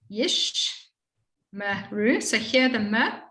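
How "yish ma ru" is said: "yish" "ma" "ru" separated by pauses; syllable by syllable. Shown as a demonstration. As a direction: break "yish, ma, ru" into beats. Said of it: "allows the consonant to say its sound".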